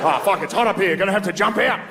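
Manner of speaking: mock-distressed Australian office worker; high, comic Australian accent. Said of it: "an Australian guy in the office".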